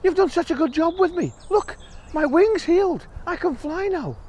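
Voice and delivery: high grateful bird voice. Acted. Bird tells the mouse her wing healed, high and grateful.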